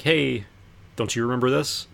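ordinary talk

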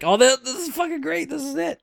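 high-pitched excited outburst, acted out; thrilled. Acting out own thrilled reaction.

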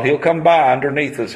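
ordinary talk

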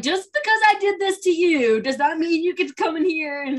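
high, loud mock telling-off; mock scolding. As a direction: scold as if putting someone in their place. Acts out actor scolding his co-star.

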